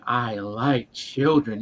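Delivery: creepy dragon voice. Retold or imitated. Imitating the dragon telling Atreyu, very slow and low. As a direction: stretch it out like a creep. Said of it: "the whole inflection of his voice".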